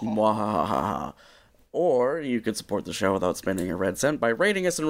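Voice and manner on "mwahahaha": slow hammed-up evil laugh; slow theatrical villain menace. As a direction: draw out a mock villain cackle. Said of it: "echoing villain laugh".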